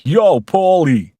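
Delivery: loud, high, drawn-out Brooklyn yell; big Brooklyn-accented call; Brooklyn shout. Acted. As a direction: holler it like a Brooklyn caller. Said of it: "shouting him in a Brooklyn accent".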